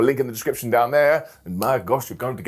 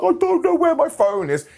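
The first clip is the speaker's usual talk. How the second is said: high, far-off voice as a clueless phone loser; clueless mock voice. Mocking a viewer who cannot find the phone, clueless and high. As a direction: play the confused fool who lost his phone. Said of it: "you're like".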